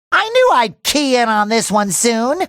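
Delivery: ordinary delivery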